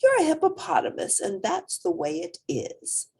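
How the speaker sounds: the mom's voice. Acted. Mom voiced in story.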